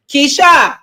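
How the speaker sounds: hammed-up church preacher call; mock preacher calling out. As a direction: call her up like a preacher to the altar. Mock pastor calling from pulpit.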